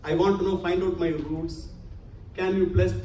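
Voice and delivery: ordinary talk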